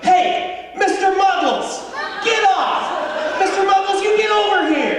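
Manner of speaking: high-pitched shout at a dog; yelling at dog. Pretend. Owner in a park shouting at jumping dog, high loud yell. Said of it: "I don't have the toughest sounding voice".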